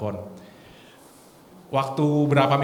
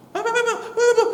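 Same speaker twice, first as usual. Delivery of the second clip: very high mimicked cry, not loud; teriak-teriak; 5 dB louder, much faster than usual. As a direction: copy the slurred patient, pitched way up. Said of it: "dia tadi tuh teriak-teriak". (tiruan teriakan pasien bernada tinggi)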